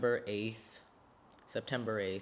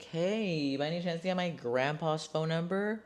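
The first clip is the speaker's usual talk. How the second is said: innocent wheedling; 8 semitones higher than usual. Retold or imitated. Niece asking her uncle for grandpa's number, innocently wheedling. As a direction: imitate yourself angling innocently for a favor, pitched way up.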